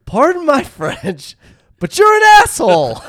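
a hammed-up principal exploding at the caller; mock outraged shout.

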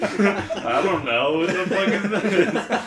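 loud, high, stretched out through laughter; laughing outburst. Pretend. Friend riffing on a baffled autopsy finding, laughing loudly. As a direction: crack up while blurting it out.

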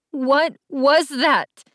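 high, drawn-out heartbroken question; heartbroken hurt. A narrator's reading. Allie confronts Jace in tears, heartbroken. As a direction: stretch each word out, hurt and high-pitched. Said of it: "My voice was filled with such heartbreak and hurt".

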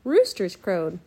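ordinary delivery, nothing marked

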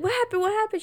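high, frantic repeated question; panicked Jose. Retold or imitated. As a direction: panic over a bleeding finger.

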